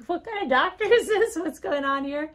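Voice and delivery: high, loud mock disbelief; high-pitched indignant questioning, played for laughs; incredulous husband. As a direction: imitate a baffled husband protesting. Husband's incredulous reaction retold.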